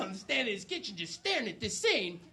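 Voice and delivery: ordinary talk, nothing marked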